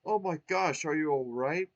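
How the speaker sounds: small star finding a trapped friend, gasping; gasped. Acted. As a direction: gasp it out in alarm. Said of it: "Twink gasped".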